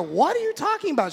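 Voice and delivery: loud, high-pitched incredulous question; mock disbelief. Husband questioning wife's refusal, incredulous. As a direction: blurt it out, baffled.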